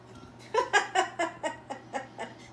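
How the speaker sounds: airy giggle at her own joke; breathy laugh. Real, not acted. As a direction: laugh off the silly voice.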